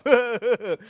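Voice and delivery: ordinary delivery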